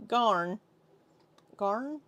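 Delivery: testing one word in an Aussie accent; Australian accent attempt. Shown as a demonstration. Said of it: "get into the accent".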